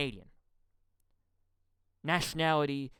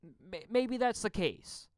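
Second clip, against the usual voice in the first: ordinary delivery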